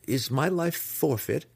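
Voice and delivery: high, pleading question; anxious cry. A narrator's reading. Anxious impostor asks the matron his fate, crying out high. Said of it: "he cried".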